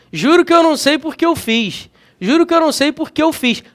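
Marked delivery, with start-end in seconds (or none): 2.2-3.6 fast casual pronunciation, por que said lightly; fast, relaxed everyday delivery; quick everyday speech. Shown as a demonstration. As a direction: rattle it off like daily talk. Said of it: "Numa fala dinâmica, rápida".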